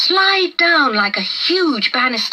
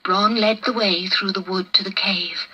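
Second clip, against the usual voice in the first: ordinary delivery, nothing marked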